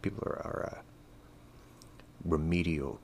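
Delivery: halting, hunting for the polite word; mock-careful correction. Shown as a demonstration. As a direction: swap in remedial with exaggerated caution, pausing before it.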